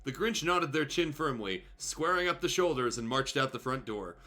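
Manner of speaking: normal talking voice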